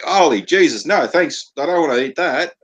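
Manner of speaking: mock disgust. Tourists refusing the food, in high mock disgust. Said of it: "They're like".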